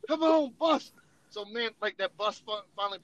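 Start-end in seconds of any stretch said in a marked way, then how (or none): 0.1-0.9 higher, pleading for the bus; will the bus to arrive; impatient urging. Retold or imitated. Soldier anxiously urging the late bus, pitched up. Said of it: "I'm like".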